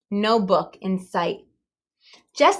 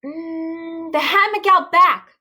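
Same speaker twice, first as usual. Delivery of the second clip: gasp; 8 semitones higher, 4 dB louder, slower than usual. A narrator's reading. Stella remembering where she left the book. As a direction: gasp it out as it comes to her. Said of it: "she gasped".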